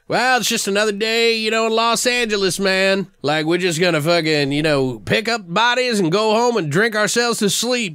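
high, loose, shrugging paramedic voice; jaded Eric. Retold or imitated. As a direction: toss it off like another day at work. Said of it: "And Eric's like".

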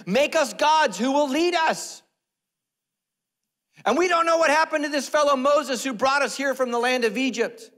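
high, loud declaimed demand; hammed-up shouting. Aaron quoting the people's demand, hammed-up shouting. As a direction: bellow it as a clamoring crowd.

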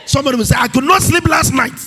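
high, loud preaching shout. Acted.

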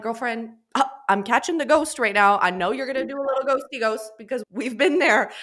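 loud, high playful teasing; teasing joke. Retold or imitated. Joking with a client about ghosting.

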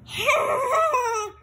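bright burst of real laughter. Real, not acted. Parent laughs after saying bye, real laugh. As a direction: laugh out loud at the goodbye.

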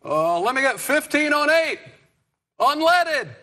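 high, loud Obama impression, measured pauses; Barack Obama. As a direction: do Obama at the gas pump. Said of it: "this is Barack Obama getting gas".

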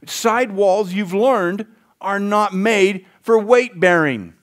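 ordinary delivery

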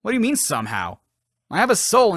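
ordinary talk, nothing marked

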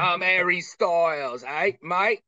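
slow, put-on British accent; a cheeky British sendoff, drawn out; a British accent to tease a friend; mock British accent. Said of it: "without a British accent".

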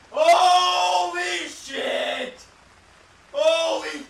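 loud, high, stretched-out swearing; frightened outcry. Real, not acted. Player shocked by a jump scare, crying out. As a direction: draw the curse out in shock.

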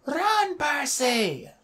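high, hushed distant shout; Annabeth shouting. A narrator's reading. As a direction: call a warning from across the grounds. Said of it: "Annabeth's voice shouted".

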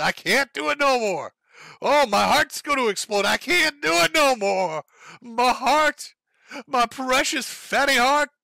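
high, loud, whiny wail of a man claiming his heart will burst; mocking Boogie whine. Mocking Boogie quitting the lawn mowing in a high whiny wail. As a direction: ham up a melodramatic complainer gasping after mowing. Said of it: "sits down and says".

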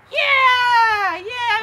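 loud, high, drawn-out yeah; excited yeah. Real, not acted. Praising kids' good week.

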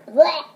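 disgusted retching sound; gagging blech. Real, not acted. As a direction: gag on the taste. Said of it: "making me gag".

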